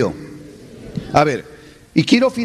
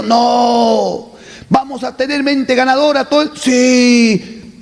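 rousing call and response; 7 semitones higher than usual. Acted. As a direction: whip up the crowd. Orator call and answer.

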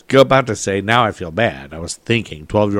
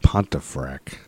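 spooky whisper; 8 semitones lower, 5 dB quieter, much slower than usual. Acted. Echoing the haunted town's name to co-host, eerie whisper.

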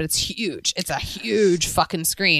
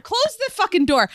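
high, raised voice toward the door; calling out; 11 semitones higher than usual. Real, not acted. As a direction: call to the next room. Yelling to boyfriend in other room.

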